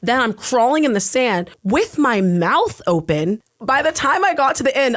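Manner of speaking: ordinary talk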